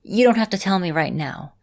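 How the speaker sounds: usual speaking voice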